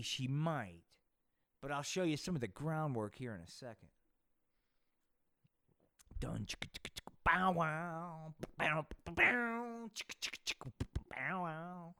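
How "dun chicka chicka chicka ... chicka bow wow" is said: beatboxed funk riff with chicka and bow sounds; mouth-made theme riff. Acted. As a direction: vocalize a cheesy porn-funk groove.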